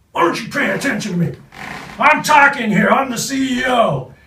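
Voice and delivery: furious high shout, fast at first; yelling. Retold or imitated. Rip yelling at the actor who ignores him, furious shout. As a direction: bellow it like a CEO nobody listens to. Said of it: "I hear this guy yelling at me".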